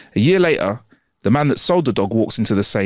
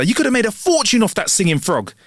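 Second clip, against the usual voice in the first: higher-pitched mock astonishment; incredulous reproach; 8 semitones higher than usual. Bartender scolds man for selling the frog, incredulous. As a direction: tell him off in disbelief.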